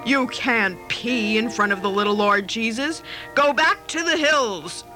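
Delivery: imperious. A narrator's reading. Child playing Joseph banishing a shepherd, imperious. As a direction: banish him with lordly authority. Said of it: "said in an imperious voice".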